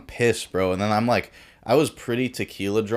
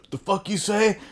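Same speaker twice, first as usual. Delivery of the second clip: mock tough challenge; 12 semitones higher than usual. Viking dude confronting the Brazilian.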